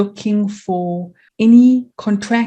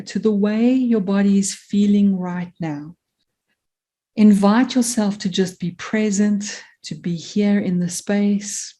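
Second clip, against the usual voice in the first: guided meditation. Acted. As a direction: gently guide them inward, letting phrases settle and fade.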